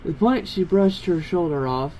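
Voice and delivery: ordinary delivery, nothing marked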